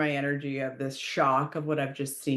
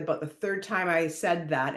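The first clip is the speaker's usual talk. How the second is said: ordinary delivery, nothing marked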